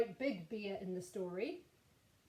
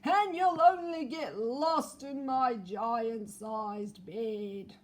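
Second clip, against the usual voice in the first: slow, loud booming bear voice; giant bear; 9 dB louder, much slower than usual. Acted. Giant bear complaining its teddy is small, slow and loud. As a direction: bellow it slowly like a huge bear. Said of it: "it said".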